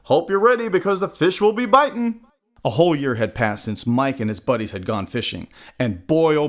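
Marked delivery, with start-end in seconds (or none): 0.0-2.2 higher, eager call; high, cheery fisherman's call; gleeful call. A narrator's reading. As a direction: call out gleefully to a young mate. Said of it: "the men gleefully called out".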